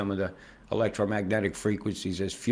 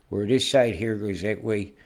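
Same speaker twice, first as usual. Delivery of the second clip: ordinary talk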